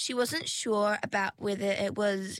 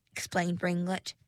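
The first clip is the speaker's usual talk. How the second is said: plain reading voice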